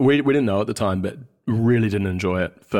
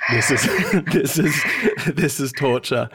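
higher mock complaint broken by laughs; laughing retold dismay; 5 semitones higher than usual. Guest recalling arriving in hot Sydney, laughing mock dismay. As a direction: laugh through the quoted complaint. Laughs while quoting the family's reaction.

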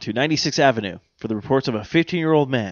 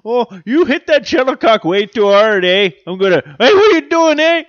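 put-on Canadian accent; 9 semitones higher, 7 dB louder than usual. Mock Canadian voice, caller.

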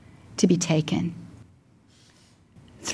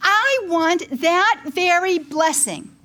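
retold screaming; 7 semitones higher than usual. Mother demanding Our Lady's blessing from the Lord, retold screaming plea. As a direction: demand it of God in a high, impassioned voice. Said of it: "I am screaming this in the bedroom".

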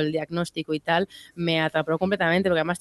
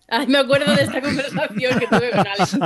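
laughing; 7 semitones higher, 4 dB louder than usual. Real, not acted. Recalls funny talk with Alex.

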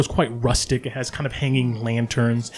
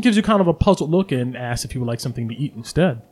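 ordinary delivery

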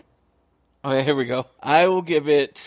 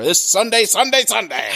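monster truck ad; 6 semitones higher than usual. Pretend. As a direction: sell it like a radio truck rally.

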